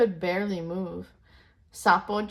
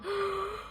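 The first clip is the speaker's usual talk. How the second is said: excited gasp; 12 semitones higher, 5 dB quieter than usual. Acted. Monkeys excited at race news.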